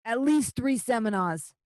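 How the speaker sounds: slow, measured official decree; stern official voice. Acted. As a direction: lay down the terms slowly.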